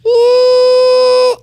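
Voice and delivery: loud, very high woo like air from a balloon; an imitated balloon squeal, piercing and loud. Shown as a demonstration. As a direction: let it squeal out like a pinched balloon. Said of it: "when it comes out like that".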